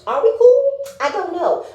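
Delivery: high and loud mock shrug; imitating a shrugging castmate; mock unsure. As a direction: toss it off in a high sing-song. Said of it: "She said".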